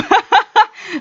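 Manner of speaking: a high burst of real laughter; high bright laugh. Real, not acted. Gamer laughing at her imagined friends, high laugh. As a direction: laugh up high at your own joke.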